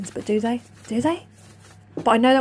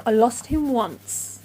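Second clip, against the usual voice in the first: normal talking voice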